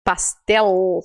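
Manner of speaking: pastel with a clear final L; Spanish pastel. Shown as a demonstration. Teacher models Spanish pastel for Brazilian learners, clear final L. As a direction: say pastel, L fully pronounced. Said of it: "eu digo pastel".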